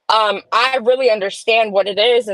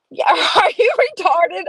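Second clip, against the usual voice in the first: high-pitched, laughing imitation of disbelief; retold incredulous question with a laugh in it; mock incredulity; 8 semitones higher than usual. Mimics partner's incredulous look.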